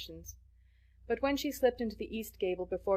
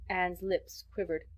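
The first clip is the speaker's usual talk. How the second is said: plain reading voice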